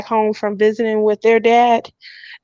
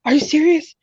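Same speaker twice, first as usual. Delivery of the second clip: higher, aghast question; shocked disbelief; 8 semitones higher than usual. Retold or imitated. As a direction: flip out at the surprise visit. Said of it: "I flipped out".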